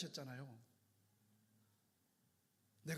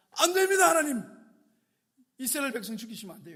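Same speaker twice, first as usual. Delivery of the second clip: high, loud cry of protest; loud refusal; 4 semitones higher, 10 dB louder, faster than usual. Acted. Moses crying out against God's plan, loud. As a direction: cry out no to God.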